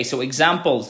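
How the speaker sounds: ordinary talk, nothing marked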